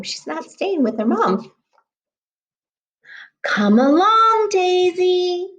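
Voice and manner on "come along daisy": high, louder call from mother duck; mother duck shouting again. Acted. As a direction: shout high for the duckling to come back. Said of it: "shouted Mama Duck again".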